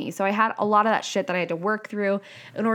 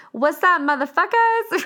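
high, loud "What's up, motherfuckers?" drawn out slowly; loud brash greeting; 9 semitones higher, 4 dB louder than usual. Retold or imitated. Walking into a room, greeting everyone with a brash yell. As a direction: burst into the room with a cheeky yell. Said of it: "walk into a room and be like".

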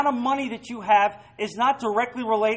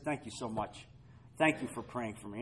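much softer, heartfelt thanks; grateful thanks; 9 semitones lower, 12 dB quieter than usual. Retold or imitated. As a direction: imitate a touched, grateful listener.

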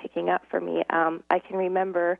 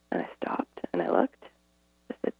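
whispered; 4 dB quieter than usual. Real, not acted. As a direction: drop to a hushed whisper. Retelling the sudden silence.

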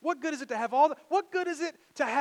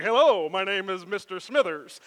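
lightly voiced suave ladies' man; mock smooth introduction; imitating a smooth charmer; 2 semitones lower, 8 dB louder than usual. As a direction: turn on the charm. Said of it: "He's like".

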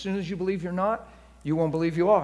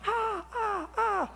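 mock rapturous cries; much slower than usual. Believer overcome by God's song in his heart, high rapturous ahs. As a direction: let out swooning high cries of worship. Imitates rapturous response to God.

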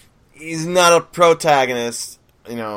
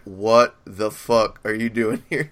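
slow, word-by-word incredulous question; deliberately slowed retold reaction; mock disbelief; 3 semitones lower, much slower than usual. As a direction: draw it out, imitating stunned disbelief. Retold stunned reaction at donut shop.

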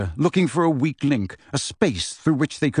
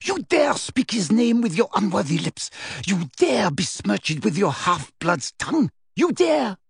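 higher, outraged witch tirade; shrieking fury; 6 semitones higher than usual. A narrator's reading. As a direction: rage at his insolence, peaking on the last line. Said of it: "Bellatrix shrieked".

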